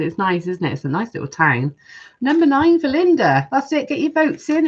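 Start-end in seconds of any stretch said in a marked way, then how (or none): 2.2-4.7 high, loud call of a score; bright proclamation of a vote to the chat; excited announcement. Real, not acted. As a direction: announce the vote like a game-show host. Announcing viewer votes on livestream.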